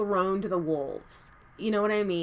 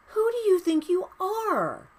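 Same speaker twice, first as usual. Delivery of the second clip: even higher, "you" punched hard in indignation; climax of rant; 10 semitones higher than usual. Real, not acted. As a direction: peak of real outrage. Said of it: "Sorry, rant over".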